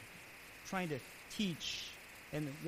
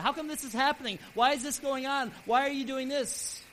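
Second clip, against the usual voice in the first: Job's raised, pleading complaints; arguing with God; 6 semitones higher, 7 dB louder than usual. Retold or imitated. Job questioning God in his trials, with loud, indignant arguing. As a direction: push back at God, higher and louder. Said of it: "he would argue about it".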